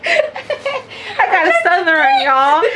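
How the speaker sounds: high, loud, laughing through the words; laughing hard. Real, not acted. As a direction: talk through a fit of giggles. Cracking up mid-sentence.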